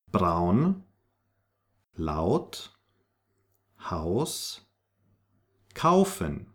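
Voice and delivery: Braun, laut, Haus, kaufen with ow; spaced list, laut as "lowt". Shown as a demonstration. Teacher models German AU, ow as in English. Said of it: "pronounced like O W".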